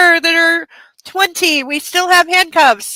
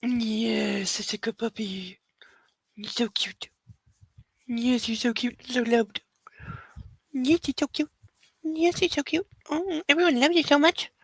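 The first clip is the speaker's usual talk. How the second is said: soft cooing to puppy; 10 dB quieter than usual. Real, not acted. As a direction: murmur fondly to your puppy, very softly.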